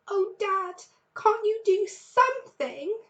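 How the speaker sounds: put-on high, slow, begging child's voice; pleading cub. A narrator's reading. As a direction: drag it out, begging Dad.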